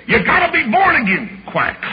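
high preaching line dropping to a short quack; duck-like preacher. Retold or imitated. Young evangelist mimicking his old pastor Duck, duck-like preaching. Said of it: "he'd preach like a duck".

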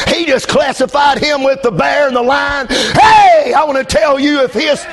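shouted, rhythmic sermon cadence; full force preaching. Acted. Preacher shouting at the congregation about Goliath, full force preaching. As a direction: hammer each line home to the church.

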